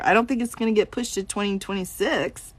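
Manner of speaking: ordinary talk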